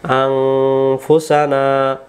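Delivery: আনফুসানা very slow, with a nasal ng before f; slow recited Anfusana. Shown as a demonstration. Teacher slowly demonstrating the nasal sound to viewers. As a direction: draw out আনফুসানা syllable by syllable. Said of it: "অনুষ্কারের মতো উচ্চারণ হবে".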